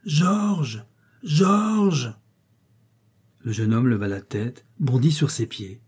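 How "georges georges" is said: high, long-drawn call of a name; voice rising in the air. A narrator's reading. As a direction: call from far away, pitched high. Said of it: "la voix de Margaret monta dans l'air". (appel aigu qui monte dans l'air)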